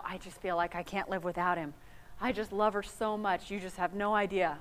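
mock lovesick confession, low and soft; understated lovestruck pleading; lovesick person. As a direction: lightly imitate someone helplessly in love. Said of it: "if I were to ask those people".

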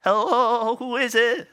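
put-on high squeaky elderly woman; squeaky old lady voice. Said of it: "in this squeaky voice".

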